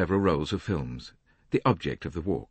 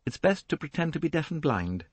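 Rosalie; 10 semitones higher than usual. A narrator's reading. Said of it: "she remarked".

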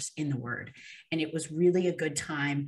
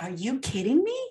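higher, rising astonished question; disbelief; 7 semitones higher, 3 dB louder than usual. Retold or imitated. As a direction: react as if you can't believe it. Retold disbelief at a sign.

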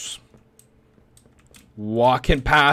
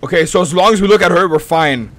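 being chased; 3 semitones higher, 6 dB louder, faster than usual. Real, not acted. Fleeing monster, fast and loud.

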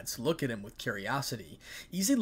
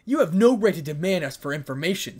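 loud, higher angry exclamation; raised voice, angered and aggrieved; 4 semitones higher, 10 dB louder than usual. A narrator's reading. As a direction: exclaim it as if affronted. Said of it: "exclaimed in an aggrieved, angered tone".

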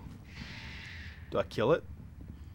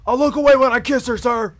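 shouting recruit; 10 semitones higher, 9 dB louder than usual. Pretend. Recruit answering drill sergeant.

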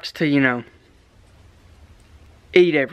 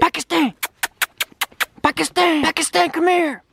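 calling dog; 7 semitones higher than usual. Real, not acted.

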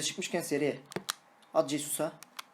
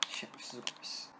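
whispered insult; 13 dB quieter than usual. Real, not acted. Rakibe fısıltıyla küfür.

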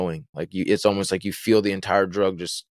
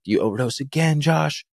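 higher-pitched retelling of an upset mom; distraught mother, imitated; 6 semitones higher than usual. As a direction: voice a mother who is a mess, without real tears. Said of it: "she's a mess".

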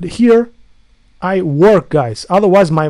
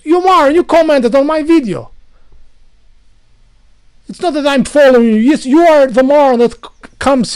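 angry shout; 8 semitones higher, 5 dB louder than usual. Real, not acted. Angry reply to commenter.

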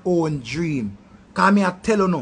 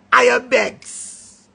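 mocking; 2 semitones higher, much slower than usual. Real, not acted. Mocking wedding beggars.

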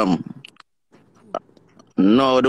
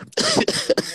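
high giggle; 4 dB quieter than usual. Real, not acted. Friends joking, high laugh.

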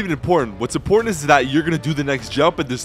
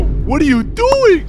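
ordinary delivery

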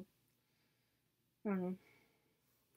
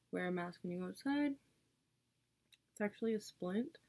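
ordinary talk, nothing marked

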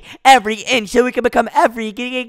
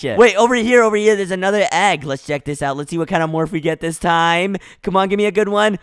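ordinary delivery

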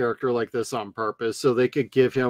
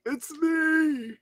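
hoarse plea; 13 semitones higher, 6 dB quieter than usual. Retold or imitated. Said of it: "voicing the disfigured man".

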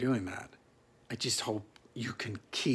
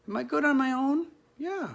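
"Am I good on my own? Yeah." asked and answered much higher; high answering voice; 14 semitones higher, 4 dB louder, faster than usual. Acted. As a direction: pitch the self-check up high, playfully. Answers his own question in voice.

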